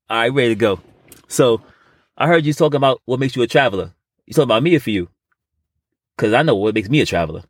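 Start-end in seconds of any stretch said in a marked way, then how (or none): none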